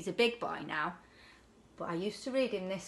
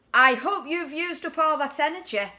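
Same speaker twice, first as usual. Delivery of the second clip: mum's voice; 7 semitones higher, 8 dB louder than usual. Acted. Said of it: "said his mum".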